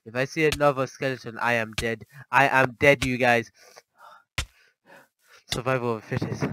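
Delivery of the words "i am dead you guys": panicked shout. Real, not acted. Fears skeletons.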